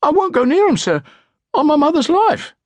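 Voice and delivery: put-on high panicked street boy protest; squawking urchin. A narrator's reading. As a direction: squeal it like a cornered bully boy. Frightened bully urchin swearing.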